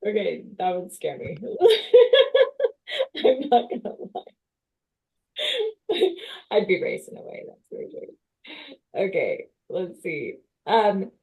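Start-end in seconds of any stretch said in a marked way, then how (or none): 1.4-3.2 loud high-pitched laugh; high burst of laughter. Real, not acted. Host laughs loudly. 3.2-9.1 laughing. Real, not acted. Laughing while talking.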